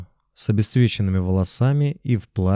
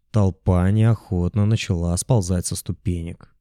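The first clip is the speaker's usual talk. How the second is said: ordinary delivery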